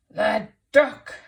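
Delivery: very loud villain exclamation; thief's voice. A narrator's reading. Teacher voices Hugh the thief, loud villain voice. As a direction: announce it like a storybook baddie.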